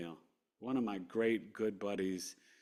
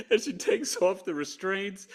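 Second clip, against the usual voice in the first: laughing; 11 semitones higher, 5 dB louder than usual. Real, not acted. Laughing while retelling.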